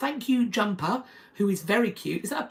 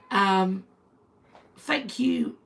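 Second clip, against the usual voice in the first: usual speaking voice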